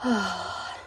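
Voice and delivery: a soft, long sigh of relief; relieved sigh. A narrator's reading. Little gnome relaxing after mum's explanation, soft relieved sigh. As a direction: let the tension go with a quiet sigh. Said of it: "felt his little shoulders gently relax".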